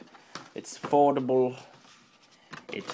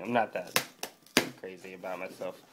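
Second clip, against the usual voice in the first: normal talking voice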